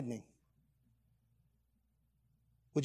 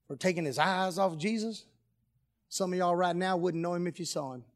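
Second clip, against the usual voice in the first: ordinary talk, nothing marked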